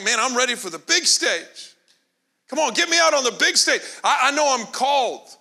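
hammed-up eagerness; eager impatience. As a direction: play the impatient believer begging for the stage. Voicing eager believers' inner thoughts.

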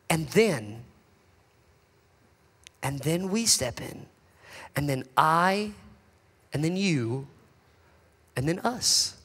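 soft and low, halting; hushed build. Real, not acted. Preacher drawing the church in, hushed and halting. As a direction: draw them in quietly.

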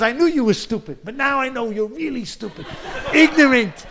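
high, loud, laughing tirade; mock furious butcher. Butcher berating the baker, loud and high. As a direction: ham up a butcher berating his neighbour.

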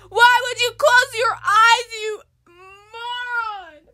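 high, loud sobbing shouts; wailing crying. Acted. Girl crying loudly at her friend over the haircut. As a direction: wail in despair over the hair.

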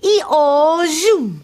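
loud, high braying zoom; donkey ee-oh zoom. Shown as a demonstration. Storyteller doing Dennis the speedy donkey. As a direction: bray it out and zoom off. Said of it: "Can you do that with me? Ready?".

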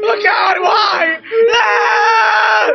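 high shrieks with a crying edge; frantic, very loud wailing; terrified screaming. Real, not acted. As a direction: scream and wail in panic. Monster chase in the game.

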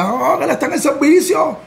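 retold crying, pitched well up; imitated tearful woman. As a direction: imitate a woman wailing through tears. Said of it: "la mujer llorando y me decía".

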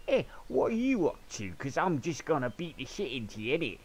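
loud high mock Cockney thug; bad Cockney accent. Gamer mocking villains, hammed-up Cockney accent. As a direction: ham up a Cockney bruiser. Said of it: "bad Cockney English accent".

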